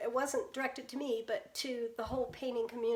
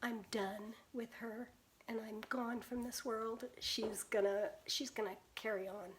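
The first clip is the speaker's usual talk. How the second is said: hushed aside; 3 semitones lower, 9 dB quieter than usual. Real, not acted. Tender aside about granddaughter.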